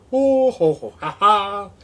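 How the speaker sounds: high, loud mock villain laugh; hammed-up laugh. Stoned vlogger laughing at his camera, hammed-up villain laugh. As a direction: cackle like a cartoon baddie.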